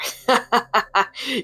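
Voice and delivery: bursting into helpless laughter. A narrator's reading. Raiden cracking up at Fujin in a dress, laughing hard. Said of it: "burst into laughter".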